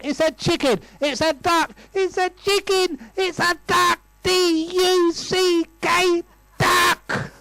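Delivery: mock shouting match climbing to a high-pitched yell; escalating quarrel shouting. Newlyweds arguing over chicken or duck, hammed-up escalating shouts.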